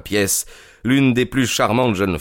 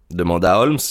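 plain reading voice

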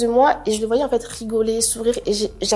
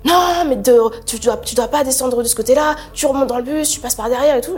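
raised, sharp, high scolding; retold yelling; 4 semitones higher, 5 dB louder than usual. Inspector yelling at passenger to get back on bus, loud and high. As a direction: bark the order at her like an angry inspector.

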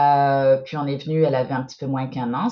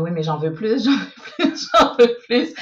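higher, laughing, pushy coach's demand; laughing mock coach; 6 semitones higher, 5 dB louder than usual. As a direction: play the demanding coach while cracking up. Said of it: "l'entraîneur de patin qui demande".

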